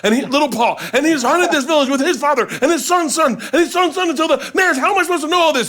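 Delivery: hammed-up exasperation, pitched high and gathering speed; exasperated rant. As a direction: act out a livid friend fed up with the movie. [name] livid, ranting at the movie.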